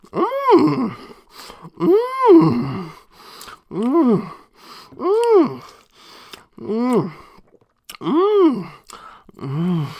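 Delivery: high appreciative moans; savoring moans. Real, not acted. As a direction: moan with pleasure while chewing. Savoring a bite of meat.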